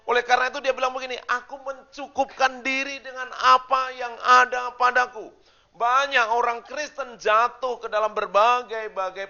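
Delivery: loud, high, ringing sermon voice; full-force preaching. Acted. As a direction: preach it out to the whole church, slowing on the key lines. Khotbah dengan suara penuh. (khotbah berapi-api dengan suara lantang)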